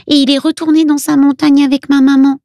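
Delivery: put-on high little-girl voice, recounting carefully; little girl character. A narrator's reading. Little girl tells Milo about the giant, high child voice. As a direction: play a small girl telling her misfortune, high-pitched.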